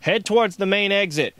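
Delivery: loud, high shouted direction; shouted order. A narrator's reading. Guard directing Josh down the hallway, shouting. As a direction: shout it as a command. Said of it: "he shouted".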